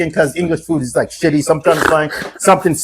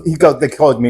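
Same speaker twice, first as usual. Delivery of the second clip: ordinary delivery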